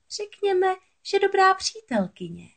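very high moray creature voice; scheming moray. A narrator's reading. Moray answering Ariel about a helper, high and coy. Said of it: "odpověděla Rafie".

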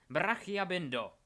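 loud, high, drawn-out spell; shouted incantation. A narrator's reading. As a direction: hurl the spell with full voice. Unknown rescuer casts a binding spell.

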